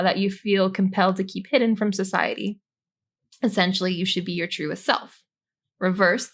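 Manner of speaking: ordinary delivery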